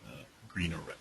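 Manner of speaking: hoarse, fading voice; losing voice. Real, not acted. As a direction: voice giving out, barely there. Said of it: "lose my voice".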